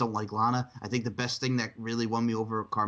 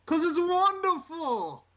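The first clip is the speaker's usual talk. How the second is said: slow, much higher, drawn out "wonderful"; hammy catchphrase, much higher; Matt Hardy impression; 4 dB louder, much slower than usual. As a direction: stretch it out high and grand.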